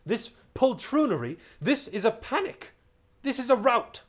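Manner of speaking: high, loud scandalized scolding; indignant outrage. Acted. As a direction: scold the king for this cowardice. Mouse scolds the crew.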